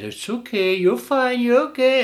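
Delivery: high soothing coo to the dog; mocking a doting owner, high and sweet.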